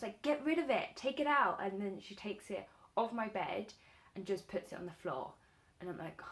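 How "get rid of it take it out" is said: high, loud frantic order; panicked shout. Retold or imitated. Begging cat to remove mouse.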